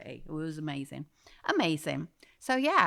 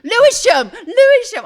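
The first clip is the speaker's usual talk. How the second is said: higher and louder, triumphant; excited correction; 13 semitones higher, 15 dB louder than usual. Real, not acted. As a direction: blurt the right name out delighted. Correcting the name, laughing.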